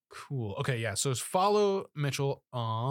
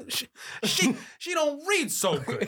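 much higher silly voice, breaking into laughter; old lady, speech impediment; 13 semitones higher than usual. Pretend. Co-host echoing the joke as the old lady, high impeded voice. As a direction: echo it in a high, impeded character voice, giggling. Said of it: "she has a speech impediment".

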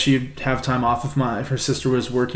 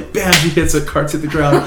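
normal talking voice

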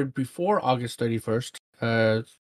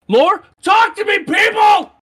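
high, loud pleading shout; exaggerated yelled quote; 9 dB louder, slower than usual. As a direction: escalate into a hammed-up yell at the crowd. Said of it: "I don't think he said it like that".

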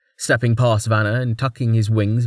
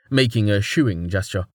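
ordinary delivery, nothing marked

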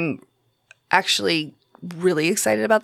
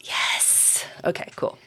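whispering; 8 dB quieter, slower than usual. Real, not acted. Whispered aside during chat.

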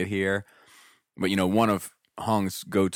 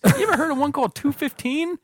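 high and louder, feigned innocence; mock innocent question; 7 dB louder than usual. Teller baiting a customer about his store, high innocent voice. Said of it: "And I was like".

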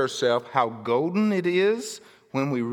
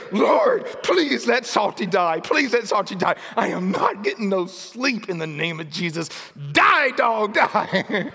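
praying in anger; 5 semitones higher than usual. Retold or imitated. Kitchen prayer in anger over the dog. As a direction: beg the Lord, fed up. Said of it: "in anger".

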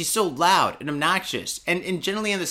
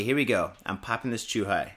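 ordinary talk, nothing marked

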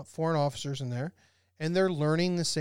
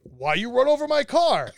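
put-on high, loud, accented protest; imitated indignant complaint; 9 semitones higher, 9 dB louder than usual. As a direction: play an outraged car owner, loud and pitched up. Angry car owners in [name]'s story.

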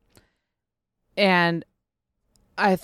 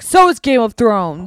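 louder, drawn-out triumphant retort; smug comeback; 7 dB louder than usual. Retold or imitated. Teacher retelling how she shut down a student, smug comeback. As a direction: land the comeback slowly and firmly.

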